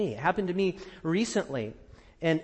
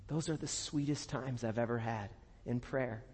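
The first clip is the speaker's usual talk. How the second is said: ordinary talk, nothing marked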